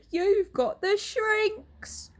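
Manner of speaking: high, slow, stretched-out taunt; Mr Twit crowing. Acted. Husband points at wife, slow high mocking cry. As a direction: drag it out to scare her. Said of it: "cried Mister Twit".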